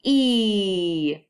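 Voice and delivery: "E" with lips spread as if smiling; long E sound. Shown as a demonstration. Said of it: "say E with me".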